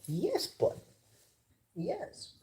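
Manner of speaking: high, soft cooing to the cat; pet talk. Real, not acted. Soothing the crying cat, high soft coo.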